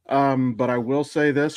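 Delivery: usual speaking voice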